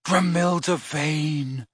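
slow, soft, dreamy name; said softly. A narrator's reading. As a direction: breathe her name adoringly. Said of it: "said Ron softly".

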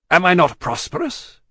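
blustering challenge; indignant demand. A narrator's reading. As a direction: press the point with wounded pride. Stryver presses his qualifications.

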